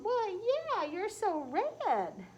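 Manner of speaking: very high, loud and giggly; lamb character voice. Acted. Bouncy lamb laughing at red Tom, high giggly loud voice. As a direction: squeal it like a bouncy little lamb.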